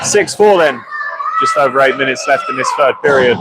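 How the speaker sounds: steady live hockey commentary; play-by-play. Acted. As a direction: call the game as it unfolds.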